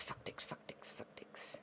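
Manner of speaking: very soft repeated whisper; whispered cursing. Real, not acted. Player hearing hissing next door, whispered cursing. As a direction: hiss it under your breath, fading away. Monster heard in the adjacent room.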